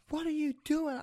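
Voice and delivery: shrill imitation of an alarmed instructor; teacher yelling. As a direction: squeal it like the frantic driving teacher. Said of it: "You know, yelling at me".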